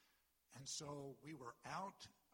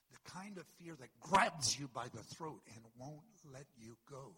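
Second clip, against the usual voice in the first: much louder, pressing hard on grabs; forceful preaching; 10 dB louder than usual. Real, not acted. Pastor hammering the congregation about gripping fear. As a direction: preach it like it has them by the throat.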